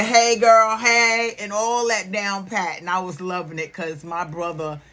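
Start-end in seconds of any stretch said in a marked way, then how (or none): 0.0-1.3 put-on high, loud "hey girl" with attitude; sassy greeting. Brother imitating his sister, sassy and high. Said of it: "He had the neck roll".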